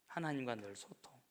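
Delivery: ordinary delivery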